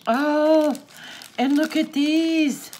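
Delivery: high, loud cry of delight; delighted awe. Real, not acted. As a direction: exclaim over a lovely discovery. Delighted at next item.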